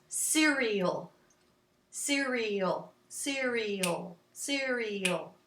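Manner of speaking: slow 'cereal', falling on a long ending; measured chant of one word; word in rhythm. Shown as a demonstration. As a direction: repeat 'cereal' evenly, stretching the end. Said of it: "that sounds pretty short".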